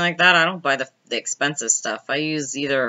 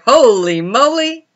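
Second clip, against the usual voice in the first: drawn-out, higher-pitched mock astonishment; acted amazement; 6 semitones higher, 4 dB louder, slower than usual. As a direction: stretch it out as if stunned. Acting out shock at the sight.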